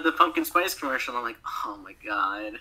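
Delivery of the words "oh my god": soft whispered amazement; hushed mock awe. Retelling seeing the pumpkin spice commercial, hushed mock awe. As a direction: whisper it as if stunned.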